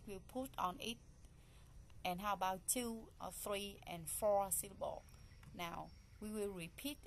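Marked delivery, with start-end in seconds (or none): none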